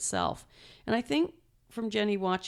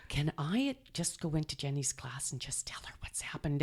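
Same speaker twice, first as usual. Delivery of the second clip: hushed, unvoiced whisper to the staff; 2 semitones lower, 7 dB quieter than usual. Mom whispering to the school staff to let her into class, hushed whisper. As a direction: whisper the request so the class isn't disturbed.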